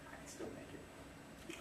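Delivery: slow, hushed whisper to herself; slow whisper, tempted to flee; whispered thought. Retold or imitated. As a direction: breathe it like a secret escape plan. Eyeing the exit sign, panicking.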